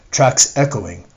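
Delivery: ordinary delivery, nothing marked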